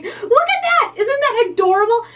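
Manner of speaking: high, loud delighted outburst; almost scream with delight; real squealing excitement. Real, not acted. Crafter showing viewers the stamped shadow, thrilled and squealing. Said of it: "I'm gonna scream. That is so exciting".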